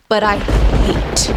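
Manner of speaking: slow, loud, drawn-out HATE; dramatic hate. Pretend. Writer venting about not editing, dramatic emphasis. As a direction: lean long and hard on "hate".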